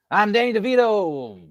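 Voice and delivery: high, loud, drawn-out celebrity impression; Danny DeVito. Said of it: "interviews with DeVito where he's like".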